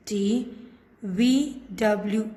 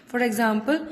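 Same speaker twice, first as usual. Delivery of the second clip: ordinary talk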